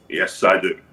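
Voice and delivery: "Yes, I do" dropped very low and level; low-pitched admission. Acted. Leader confirms the robbery, "Yes, I do" said very low. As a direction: repeat "Yes, I do" deep down in pitch. Repeats confession in lower voice.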